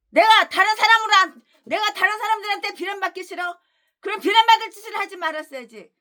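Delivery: high, fast scolding; mock them, then slam the verdict; taunting rebuke. Real, not acted. Preacher taunting critics who hate blame.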